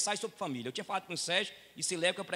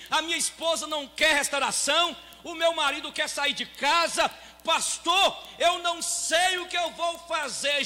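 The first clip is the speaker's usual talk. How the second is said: raised, ringing pulpit voice, higher and louder; fiery preaching; 9 semitones higher, 10 dB louder, slower than usual. Acted. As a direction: preach it out to the whole church. Pastor preaching at full force.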